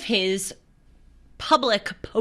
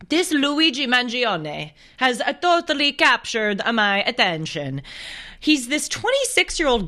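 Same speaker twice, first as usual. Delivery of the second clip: mock Italian accent; 5 semitones higher than usual. Host introducing a murder suspect, playful Italian accent. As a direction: lilt it like a bad Italian impression. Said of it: "talk in my Italian accent".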